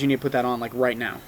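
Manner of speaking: low, hushed order; said just like that. Retold or imitated. Caleb throws over a shirt and tells him, quiet low order. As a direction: drop low and soft, understated command. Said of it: "he said it just like that".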